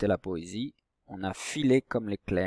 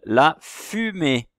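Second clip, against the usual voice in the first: "fumée" slow and loud, syllable by syllable; first syllable emphasized; 4 semitones higher, 7 dB louder, much slower than usual. Shown as a demonstration. Teacher names the word for pupils to find its first syllable, "fumée" slowly sounded out. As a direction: sound out "fu-mée" with a long, strong "fu". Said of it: "la première syllabe du mot fumée".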